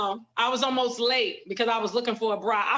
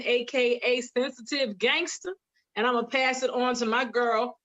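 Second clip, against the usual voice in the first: ordinary talk, nothing marked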